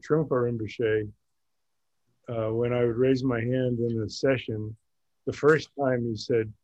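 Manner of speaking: ordinary delivery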